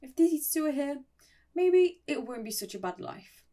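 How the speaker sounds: high-pitched mock-happy brightening; lightly acted giddy delight; happy, giggly. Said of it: "We're, like, giggling".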